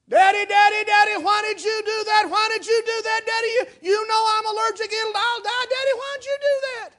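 put-on high, loud, frantic child's cry; hysterical shout. Girl cries out at her dad after he grabbed the bee, high and frantic. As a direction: shriek it at her father as if he has doomed her. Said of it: "the little girl went crazy".

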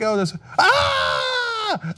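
high, slow mock shriek of fright. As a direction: shriek as if a ghost appeared. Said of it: "you're like".

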